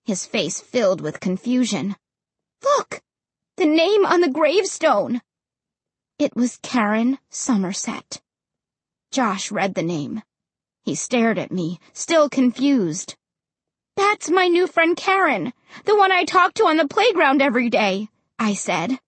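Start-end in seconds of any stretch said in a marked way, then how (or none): 2.6-5.2 high, urgent exclamation; startled, high-pitched insistence; alarmed. A narrator's reading. Alarmed at name on gravestone. 14.0-18.1 high, hurried explanation; worried kid, speeding up; agitated revelation. A narrator's reading. Shocked recognition of friend's name.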